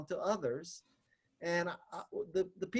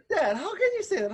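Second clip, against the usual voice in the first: laughing protest; 9 semitones higher, faster than usual. Real, not acted. Retelling protest to father, laughing.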